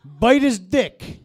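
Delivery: loud, slow, drawn-out taunt; mock threat. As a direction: bellow it slowly as a hammed-up challenge. Act's big move with dog.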